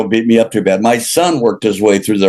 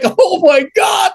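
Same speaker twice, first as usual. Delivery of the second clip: slow, high, laughing "oh my God"; a stretched, high-pitched gasp of embarrassment; mock shock; slower than usual. Caught on speakerphone, he laughs out a high, slow "oh my God".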